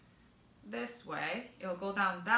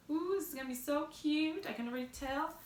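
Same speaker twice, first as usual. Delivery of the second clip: delighted; 7 semitones higher, 4 dB quieter than usual. Real, not acted.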